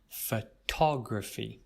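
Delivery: Photography with TOG stressed, slow. Shown as a demonstration. Teacher showing students the stress, slow and deliberate. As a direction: hit the second syllable of Photography. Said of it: "we stress the second syllable, the tog sound".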